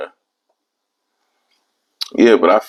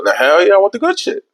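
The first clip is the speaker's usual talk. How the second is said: high excited demand; mock greedy excitement; eager; 11 semitones higher, faster than usual. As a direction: grab for the good stuff, voice jumping high. Excited craving the good stuff.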